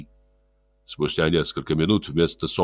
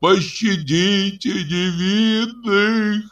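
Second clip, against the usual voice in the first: slow high weeping plea; плачущая мольба; 13 semitones higher, much slower than usual. A narrator's reading. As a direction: drag it out, weeping. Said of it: "стонал и плакал народ". (плачущая мольба народа)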